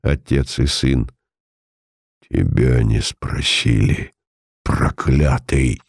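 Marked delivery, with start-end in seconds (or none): 2.3-5.8 spiteful. A narrator's reading. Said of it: "Злобно и мрачно".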